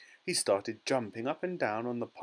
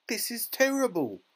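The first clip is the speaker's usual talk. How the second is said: high, louder, despairing lament; wailing; 12 semitones higher, 5 dB louder than usual. A narrator's reading. Mother despairs over her shrunken son, wailing. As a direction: wail it in dismay. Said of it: "wailed Missus Teavee".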